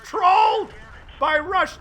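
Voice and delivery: long loud cry then high shouted groan; dismayed cry. Real, not acted. Commentator crying out as his hero gets killed. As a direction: wail at the sudden loss.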